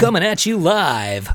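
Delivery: radio DJ. Pretend. Shock jock radio bit.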